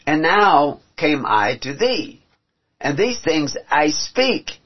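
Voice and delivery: slow solemn reading of scripture; solemn scripture read aloud. Acted. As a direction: read it slow and weighty like holy writ. Reads scripture slowly, solemn.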